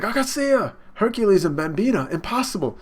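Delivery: high, loud mock exclamations; acted shocked outcry. Onlookers see the kings arrive, high loud acted shock. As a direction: exclaim each name in hammed-up high astonishment.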